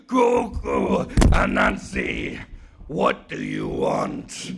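slow, rasping giant voice; drawn-out, very low godly growl; giant sky god. Acted. Sky god questions tiny Anansi, slow giant voice.

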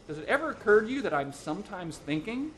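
aggrieved husband finding some backbone; indignant protest. A narrator's reading. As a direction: push back against being nagged. Henpecked husband protests to his wife.

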